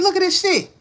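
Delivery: high, loud excited cry; excited exclamation. Retold or imitated. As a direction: show off the cards with a burst.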